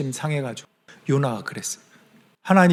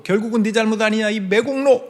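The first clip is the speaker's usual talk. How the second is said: quick, higher jeering accusation; mock accusation; 7 semitones higher than usual. Crowd jeering the returning prophet, quick high accusation. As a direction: fire off the blame like a scornful crowd.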